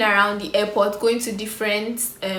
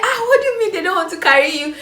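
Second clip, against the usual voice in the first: laughing; 10 semitones higher, 6 dB louder, faster than usual. Real, not acted. Dad's reaction, retold laughing.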